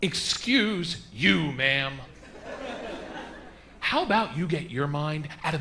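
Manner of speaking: very slow, measured retort; deliberate comeback. Retold or imitated. Comic staring her back down, slow retort. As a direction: draw out each word, unintimidated. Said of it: "I stared her back in her face".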